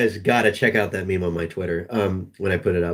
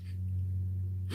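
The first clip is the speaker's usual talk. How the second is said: breathy laugh; 14 dB quieter than usual. Real, not acted. Cracking up.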